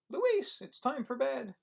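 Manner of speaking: high, slow call from another room. Acted. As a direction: call the child in for bed. Mom calls Luis to bed.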